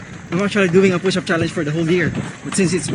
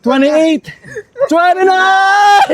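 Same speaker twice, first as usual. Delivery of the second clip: straining through the last reps; higher, louder count, last word stretched; doing push ups; 7 semitones higher, 4 dB louder, slower than usual. Real, not acted. Final reps of push-up set.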